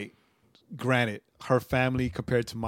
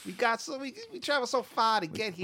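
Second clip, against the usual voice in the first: higher, put-on Boston accent, hammed-up; bad Boston pilgrim voice, played for laughs; terrible Boston accent; 9 semitones higher, 5 dB louder, slower than usual. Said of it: "That's a terrible Boston accent".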